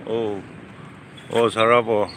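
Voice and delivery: usual speaking voice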